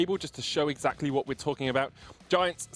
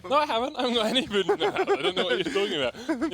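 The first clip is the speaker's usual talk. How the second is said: laughing; 9 semitones higher than usual. Real, not acted. Laughing denial of food.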